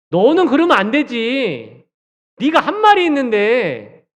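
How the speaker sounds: loud, high, indignant acted scolding; berating. Imagined Jesus confronting Peter over his denial, berating. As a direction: lay into them with drawn-out, accusing reproach. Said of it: "윽박지르시지도 않으시고요".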